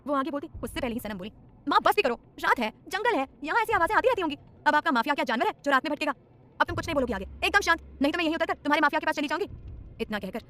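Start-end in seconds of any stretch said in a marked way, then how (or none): 1.7-9.5 higher, louder, bossy daughter; teasing scold. A narrator's reading. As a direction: cut mom off with playful exasperation. Daughter teasing scolding her mother.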